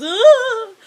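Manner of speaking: high, loud mock "ooh" cry; sudden hammed-up yelp of surprise; plunging yelp. He falls into deep water.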